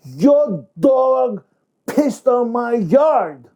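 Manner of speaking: high, loud furious accusation, slow on the last words; angry in-your-face retort. Shown as a demonstration. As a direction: get right in his face and spit it out. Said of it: "will come right in his face and says".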